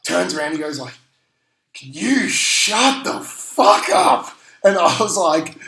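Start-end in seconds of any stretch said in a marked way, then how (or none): 1.8-4.3 slow, higher, louder mock snarl at a noisy fan; retold angry snap. Big bloke turning round to a chatty fan, mock angry snap. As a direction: drag it out like an annoyed stranger telling you off.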